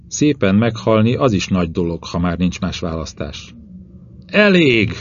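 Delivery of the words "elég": high-pitched, exasperated outburst; angry yell. A narrator's reading. Narrator cutting off his quarrelling companions, angry yell. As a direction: cry out to cut the argument off. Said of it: "ordította".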